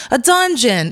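high, playful mock announcement; blurted reveal. Retelling her answer to mom, high blurted reveal. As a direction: blurt it out, pitched well up.

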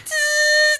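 sharp hiss through teeth; hissing in pain. Retold or imitated. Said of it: "And he's like".

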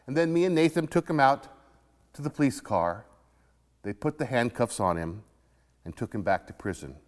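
ordinary delivery

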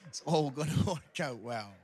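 chuckling through the words; laughing. Real, not acted. Preacher laughing with the congregation at his own joke. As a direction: laugh it out, slowly.

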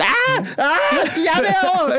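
high, hysterical shrieks; mock fan screaming. Imitating fans shrieking that they are over there, screamed. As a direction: squeal as if the band were right there. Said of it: "skrige på den måde".

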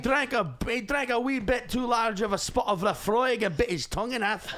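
high-pitched, broad comic Scottish brogue; Scottish accent. Acted. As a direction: improvise in a hammed-up Scots accent. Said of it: "My Scottish is better".

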